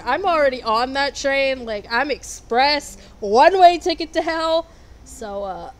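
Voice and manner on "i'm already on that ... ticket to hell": excited boast. Real, not acted. Cheerfully claims she's going to hell.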